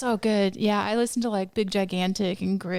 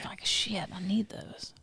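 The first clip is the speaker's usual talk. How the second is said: whispered mock panic; 7 dB quieter, slower than usual. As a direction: whisper it like a secret worry. Joke about losing teeth.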